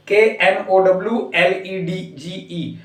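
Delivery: slow, deliberate letter-by-letter spelling; reading the spelling aloud, one letter at a time; spelled out letter by letter. Shown as a demonstration. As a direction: spell each letter out slowly and clearly. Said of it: "आप इसको क्या पढ़ेंगे".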